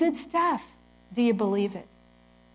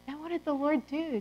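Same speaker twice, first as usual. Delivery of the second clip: usual speaking voice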